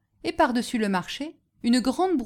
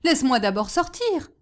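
raised, higher plea; mildly urgent call; crying out; 5 semitones higher, 4 dB louder than usual. A narrator's reading. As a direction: plead to be let out. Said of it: "s'écria le chantre dans le coffre".

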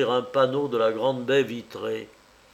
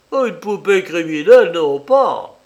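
loud, booming cavernous denial; booming demon lord; 5 semitones higher, 10 dB louder than usual. Acted. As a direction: boom it out like a prophet. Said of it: "d'une voix caverneuse".

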